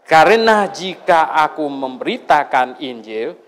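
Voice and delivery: slow, measured reading of the verse; solemn scripture reading. Acted. Preacher reading the verse to the congregation, slow and solemn. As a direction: read each phrase out deliberately, slowly. Reads the Bible verse aloud.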